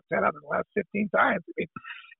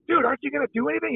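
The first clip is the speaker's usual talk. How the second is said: quick, higher mock-indignant question; retold disbelieving appeal, pitched up and hurried; incredulous question; 8 semitones higher, faster than usual. Pitcher asking a security guard for help, mock incredulous.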